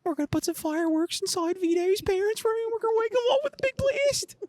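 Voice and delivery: very high-pitched, strained reality-show prankster voice; Bam Margera. Acted. Asked how Bam sounds, a high-pitched impression. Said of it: "What would Bam sound like".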